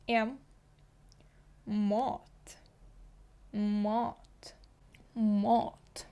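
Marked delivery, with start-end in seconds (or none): 1.7-6.1 «Мат» with a long A near O; «Мат» Swedish, dark A; «Мат» said the Swedish way, several times; Swedish mat. Shown as a demonstration. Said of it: "Ви почули, що я кажу не мат".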